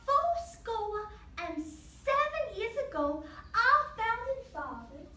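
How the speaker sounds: high, slow, stretched-out declaiming; careful recitation. Acted. Boy practicing his speech from memory, reciting slowly. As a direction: recite slowly, drawing out each word. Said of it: "by memorizing and reciting".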